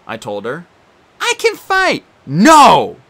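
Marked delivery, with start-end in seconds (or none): 1.2-2.1 put-on girl's voice. Annabeth voiced. 2.3-3.0 loud sharp shout; yelling. Acted. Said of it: "I yelled".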